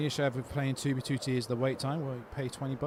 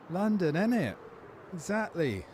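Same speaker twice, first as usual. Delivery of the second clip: put-on playful London accent; 7 semitones higher than usual. Streamer correcting a viewer's Manchester guess, playful London accent. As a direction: play up the London twang, pitched high.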